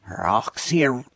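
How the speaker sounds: reversed audio clip; words played in reverse; played backwards. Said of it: "what those words sound like backwards".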